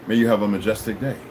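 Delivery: low-voiced formal well-wishing; calm solemn blessing. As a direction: bestow it gravely, pitched well down. Polite blessing quoted to greeters.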